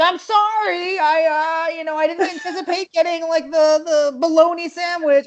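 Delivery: high, thin mock apology; apologetic lady. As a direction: imitate the customer excusing herself. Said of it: "she's like".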